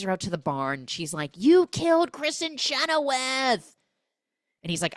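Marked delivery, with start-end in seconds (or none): 1.4-3.8 higher, louder, slow; mock horrified accusation. J-Lo to the killer in the barn, slow shocked accusation. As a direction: hurl the accusation at him.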